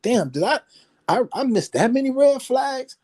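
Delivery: stunned, voice jumps way up; incredulous high. Retold or imitated. Retold disbelief, extortion.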